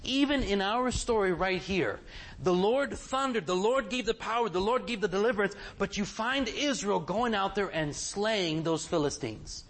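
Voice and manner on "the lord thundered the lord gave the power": ordinary delivery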